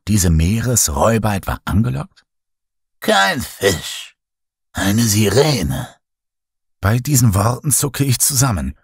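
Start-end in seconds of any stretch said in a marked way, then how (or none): 3.0-5.9 lightly voiced old storyteller, ominous. A narrator's reading. Old storyteller startles the narrator on deck, ominous.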